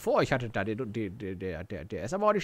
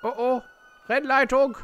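excited shout; 8 semitones higher, 4 dB louder than usual. Pretend. Spotting police, mock race commentary.

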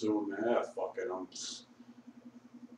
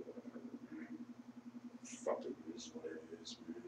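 barely voiced, trailing mumble; resigned near-whisper to himself; mumbled; 7 semitones lower, 21 dB quieter, faster than usual. Real, not acted. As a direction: mutter it under your breath. Drunk self-pity, mumbling to self.

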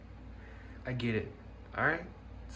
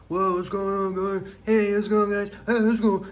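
higher, louder greeting voice; higher, louder hammed-up greeting; voice demo; 8 semitones higher, 7 dB louder than usual. Shown as a demonstration. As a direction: show off a new voice. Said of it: "I can talk differently too".

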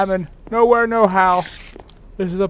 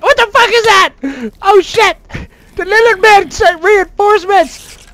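startled; 9 semitones higher, 6 dB louder than usual. Real, not acted. Startled by attacking creatures.

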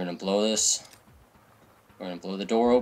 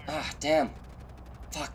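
normal talking voice

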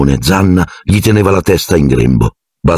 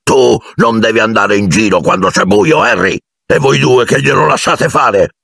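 angry scolding; 7 semitones higher than usual. A narrator's reading. Hagrid scolding Harry.

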